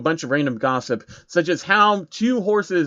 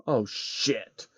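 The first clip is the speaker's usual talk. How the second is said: much softer muttered curse; angry grumble; 2 semitones lower, 7 dB quieter than usual. Acted. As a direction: grumble it angrily to yourself. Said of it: "he grumbled to himself angrily".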